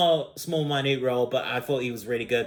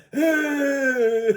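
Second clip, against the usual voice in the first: high, loud, held-out comic holler; mock cry; 13 semitones higher, 5 dB louder than usual. Reviewer imitating the prison dudes, loud mock cry.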